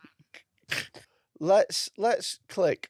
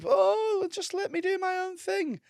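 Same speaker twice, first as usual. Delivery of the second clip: mock whiny voice. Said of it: "and they".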